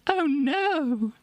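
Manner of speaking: high mock alarm. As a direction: cry it out in cartoon shock. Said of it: "And she goes".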